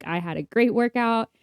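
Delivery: ordinary talk, nothing marked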